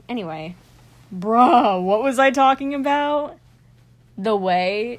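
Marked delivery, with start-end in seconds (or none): 1.2-3.4 loud, higher exasperated "bruh"; loud outburst. Real, not acted. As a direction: blurt it out loud.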